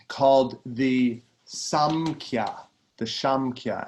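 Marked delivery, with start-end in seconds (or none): 3.1-3.9 Shamkhya with an opening sh sound; soft S. Shown as a demonstration. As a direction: soften the first S of Shamkhya to sh. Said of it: "actually it's soft S".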